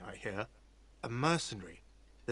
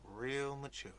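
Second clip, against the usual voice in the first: muttered whine; 7 dB quieter, slower than usual. Acted. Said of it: "a whiny voice shouts".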